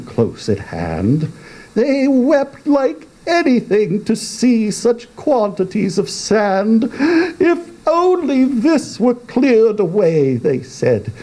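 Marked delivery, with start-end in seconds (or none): 1.7-10.4 higher, hammed-up lament; mock weeping. Walrus and carpenter lamenting the sand, mock tearful and high. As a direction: wail over the sand, comically.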